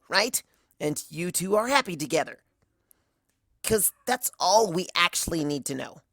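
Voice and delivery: fed-up, pleading for an end; Rainbow Dash. A narrator's reading.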